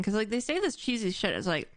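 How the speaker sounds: normal talking voice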